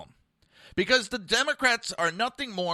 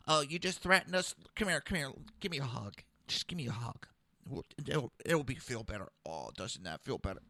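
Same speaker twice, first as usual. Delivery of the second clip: soft, low coaxing, fading quieter; mock soothing; 4 semitones lower, 10 dB quieter than usual. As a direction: coo it softly as if comforting, in mockery. Mocking a soft, hugging leader.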